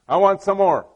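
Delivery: loud, eager and laughing; excited. Pretend. Preacher voicing a hungry believer, eager and loud. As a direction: grab it like a gift you can't get enough of. Eager quote of gospel hearer.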